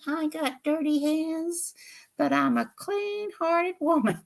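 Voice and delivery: high, slow puppet voice; Minnie the puppet. Acted. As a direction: say it proudly in the puppet voice. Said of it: "she's my puppet".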